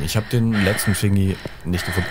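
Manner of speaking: usual speaking voice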